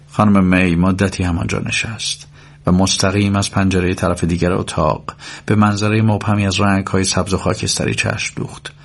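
usual speaking voice

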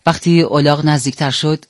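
plain reading voice

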